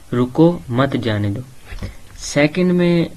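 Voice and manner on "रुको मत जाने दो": रुको stressed and rising, then मत जाने दो together; pause after रुको. Shown as a demonstration. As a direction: break right after रुको, before मत. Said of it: "वर्ड वही है लेकिन उनके डिफरेंट मीनिंग". (रुको पर ज़ोर और ठहराव, फिर मत जाने दो)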